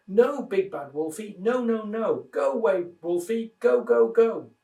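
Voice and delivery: high, rising frightened squeal; two piglets squealing at the wolf; terrified squeal. Acted. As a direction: squeal it out in panic. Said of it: "squealed in terror".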